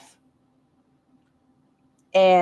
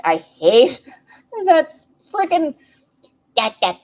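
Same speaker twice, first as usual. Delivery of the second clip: laughing; 8 semitones higher, 6 dB louder, slower than usual. Real, not acted. Laughing disbelief at a habit.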